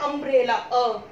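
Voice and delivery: normal talking voice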